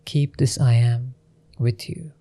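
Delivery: usual speaking voice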